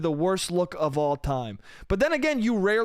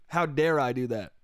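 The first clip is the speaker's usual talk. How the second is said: ordinary talk, nothing marked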